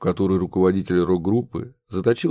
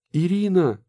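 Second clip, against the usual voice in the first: put-on boy calling softly; 10 semitones higher than usual. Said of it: "негромко позвал Максимка".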